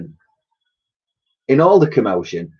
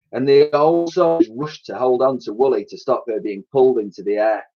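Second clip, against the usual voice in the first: ordinary delivery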